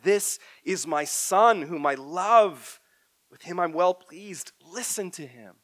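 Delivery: slow, loud, solemn divine proclamation; voice from the cloud. Acted. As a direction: announce it slowly like God from the cloud. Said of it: "a voice from the cloud said".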